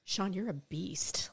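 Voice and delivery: slow, unvoiced whisper; imitated awed whisper. Imitating a guest whispering slowly in awe to Sean. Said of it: "they look at him and they're like".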